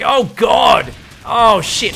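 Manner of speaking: loud, high burst of shock; real excited shout. Real, not acted. Caster reacting to a surprise siege tank with a loud excited shout.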